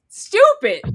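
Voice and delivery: high, loud scornful stupid; exasperated. Real, not acted. Friend scorns the man's foolishness, loud disgust. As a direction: fling the word out in disgust.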